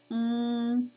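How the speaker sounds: ng hummed long in the throat; ng sound. Shown as a demonstration. Teacher letting kids feel it, drawn-out sound. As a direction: hold "ng" on one level note. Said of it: "you can feel that in your throat".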